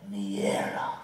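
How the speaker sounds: low growl rising to a shouted pounce; cat battle cry. Acted. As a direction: yowl then pounce.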